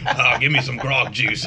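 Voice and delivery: laughing pirate voice; pirate accent through laughter. Retold or imitated. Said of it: "stop talking like a pirate".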